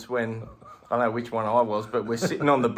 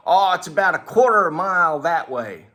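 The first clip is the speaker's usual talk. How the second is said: higher, louder, breezy helpful local; mock local giving directions; 6 semitones higher, 8 dB louder than usual. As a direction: play the cheerful stranger pointing the way. Strangers give directions, voiced higher.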